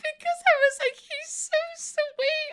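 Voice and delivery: very high, slow, tearful; teary, high voice. Real, not acted. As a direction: squeeze it out through tears. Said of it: "oh, I'm gonna cry again".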